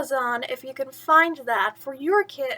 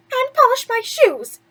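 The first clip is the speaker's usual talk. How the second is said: much higher, louder spoiled demand; barking another chore at her; bossy stepsister; 11 semitones higher, 7 dB louder than usual. Acted. Stepsister ordering Cinderella.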